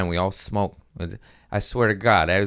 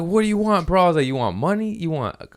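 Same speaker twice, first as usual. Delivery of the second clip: high-pitched retold panic; mock frantic, pitched up; about to cry; 9 semitones higher than usual. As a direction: plead as if close to tears. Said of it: "I'm, like, about to cry".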